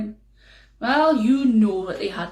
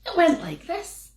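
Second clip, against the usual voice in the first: plain reading voice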